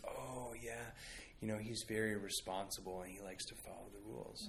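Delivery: soft, low, understated reply; hushed, matter-of-fact voice; calm teacher. Retold or imitated. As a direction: quote the teacher quietly and knowingly. Teacher calmly explaining the child.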